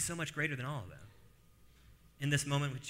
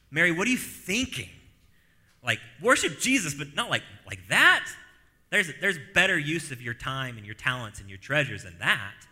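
louder, higher mock scolding; a touch of indignant scolding, higher and louder; scolding disciples; 3 semitones higher, 8 dB louder than usual. Disciples scolding Mary for wasting ointment, hammed-up indignant.

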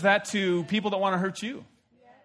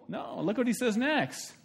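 ordinary talk, nothing marked

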